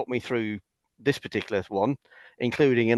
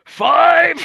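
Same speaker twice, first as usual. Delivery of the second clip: very high, loud mock squeal; hammed-up shriek, voice shooting up octaves; imitating pained countdown; 12 dB louder than usual. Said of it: "He's there going".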